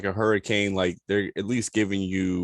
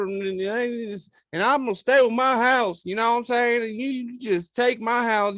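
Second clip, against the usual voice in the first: put-on Louisiana local accent; 13 semitones higher, 3 dB louder than usual. Mocking hurricane-defiant locals in a Louisiana accent. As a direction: ham up a Southern Louisiana accent. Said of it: "I should be able to have the accent down".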